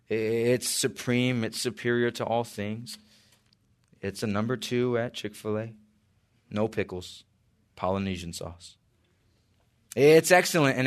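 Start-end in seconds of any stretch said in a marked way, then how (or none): 0.1-8.8 quiet aside. Real, not acted. Quiet joke aside about Chick-fil-A.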